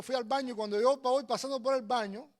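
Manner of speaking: normal talking voice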